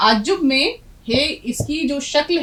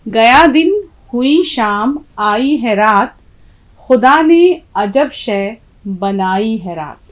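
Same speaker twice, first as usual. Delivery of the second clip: slow, louder reading of the full couplet; slow poem recitation; 6 dB louder, slower than usual. Shown as a demonstration. Teacher reading the full couplet to the class, slow and declaiming. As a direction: let the couplet ring out, line by line. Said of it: "अब देखिए".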